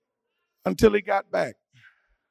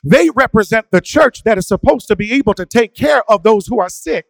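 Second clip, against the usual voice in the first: raised, ringing sermon delivery; preaching; 7 semitones higher, 11 dB louder than usual. Acted. A pastor preaching to his church, fervent and raised. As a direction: preach it to the congregation with rising force.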